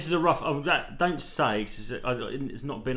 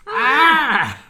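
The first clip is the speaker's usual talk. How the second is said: very loud, high-pitched eagle shriek; eagle screech; 12 dB louder than usual. Acted. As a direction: let rip a piercing eagle cry. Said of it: "That's your eagle impression".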